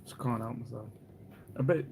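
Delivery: ordinary delivery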